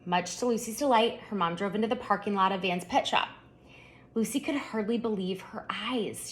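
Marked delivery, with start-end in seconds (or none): none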